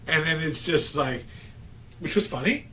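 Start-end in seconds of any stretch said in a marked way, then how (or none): none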